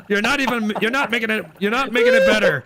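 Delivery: laughing. Real, not acted. Scolding friend, laughing.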